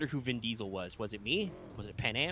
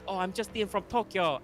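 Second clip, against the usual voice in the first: higher, hammed-up Japanese accent; mock Japanese accent, pitched up; exaggerated Japanese accent; 7 semitones higher than usual. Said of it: "talks in an exaggerated Japanese accent".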